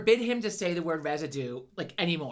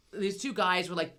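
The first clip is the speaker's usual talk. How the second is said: ordinary talk, nothing marked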